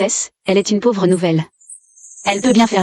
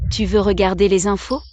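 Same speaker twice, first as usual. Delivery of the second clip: normal talking voice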